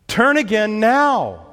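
loud, high, drawn-out command; emphatic exclamation. Acted. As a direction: cry it out as an urgent plea to God. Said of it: "Exclamation point".

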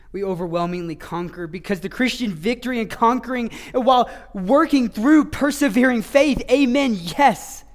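preacher reaching the climax of the sermon; climactic preaching. Acted. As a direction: rise to a triumphant peak.

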